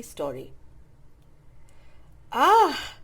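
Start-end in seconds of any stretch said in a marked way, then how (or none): 2.3-3.0 dreamy, breathed out loud and high; dreamy sigh of a happy owl. A narrator's reading. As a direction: let out a dreamy, delighted sigh. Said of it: "breathed the blue owl dreamily".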